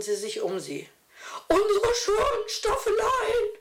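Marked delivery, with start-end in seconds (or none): 1.5-3.5 put-on high-pitched, whining lament from an old lady; a pitched-up, put-on whine of complaint. A narrator's reading. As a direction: wail over the ruined easels in a high voice. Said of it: "jammerte eine".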